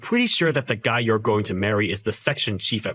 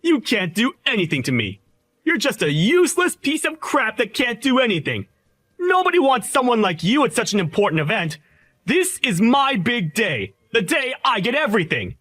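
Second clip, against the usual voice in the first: angry taunting; 8 semitones higher than usual. Acted. Groom taunting rival at wedding.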